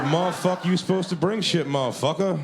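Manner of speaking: confrontational host. Retold or imitated. Party host berates hired entertainer, confrontational. Retold host repeats his angry demand.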